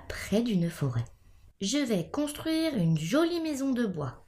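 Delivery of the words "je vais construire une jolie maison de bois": higher, eager little pig's cry; exclaiming. A narrator's reading. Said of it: "s'écria-t-il". (exclamation enjouée)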